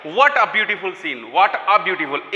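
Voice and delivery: very slow, dictating, with "a" marked after "What"; teacher dictates the sentence, weighting "a"; stress on the article. Shown as a demonstration. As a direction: spell it out slowly: "What a beautiful". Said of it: "ए लग गया इसमें".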